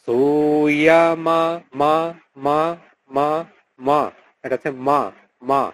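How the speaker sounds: মা slow with open short অ, repeated; correct মা. Shown as a demonstration. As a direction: drill মা with Sanskrit অ. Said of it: "এটার উচ্চারণটা". (সংস্কৃত অ-ধ্বনিতে মা বারবার)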